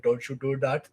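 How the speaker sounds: put-on broad Indian accent. Shown as a demonstration. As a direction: demonstrate a stock Indian accent. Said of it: "two different types of Indian accents".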